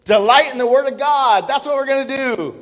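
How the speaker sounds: "Delight in the Word of God" called out high and loud; loud preaching. Real, not acted. Preacher booming the sermon title at the congregation. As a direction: proclaim it to the whole room. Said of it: "loud preaching declaration".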